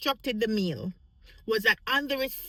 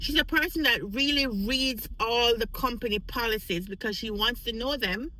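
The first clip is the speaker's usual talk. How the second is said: normal talking voice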